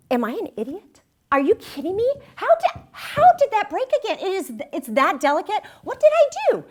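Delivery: high, loud exasperated self-reproach; self-scolding outburst. Retold or imitated. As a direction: berate yourself in disbelief. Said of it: "I'm just mad at myself".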